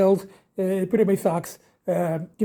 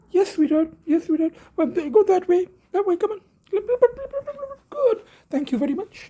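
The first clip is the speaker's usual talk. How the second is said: raised, sing-song voice for the cat; higher pet voice, herding her gently along; high coaxing pet talk; 12 semitones higher than usual. Real, not acted. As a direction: sweet-talk the cat out the door.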